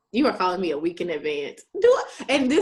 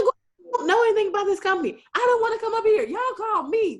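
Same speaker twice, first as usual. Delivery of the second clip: high, louder, quick sass; sassy defiance; 13 semitones higher, 4 dB louder than usual. Retold or imitated. Answering the rude interviewer, high and sassy. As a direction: toss it back with attitude. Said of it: "I'm like".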